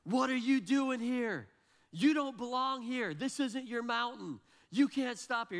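loud, high-pitched berating yell; imitating an angry screamer. Stranger screaming at kids on the slope, mock-furious yell. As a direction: yell it slowly, high and outraged. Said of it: "screaming at my kids".

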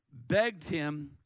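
usual speaking voice

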